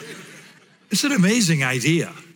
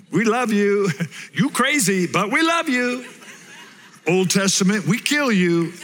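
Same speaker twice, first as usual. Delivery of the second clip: laughing mock voices; 6 semitones higher than usual. Jokey Old vs New Testament voices.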